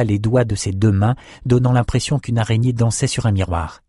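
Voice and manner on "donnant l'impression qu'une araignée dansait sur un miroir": ordinary delivery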